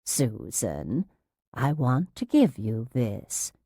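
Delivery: soft, low, slow nurse; syrupy, hushed calm; patronizing coaxing. A narrator's reading. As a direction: coax a difficult patient gently. Patronizing nurse coaxing agitated patient.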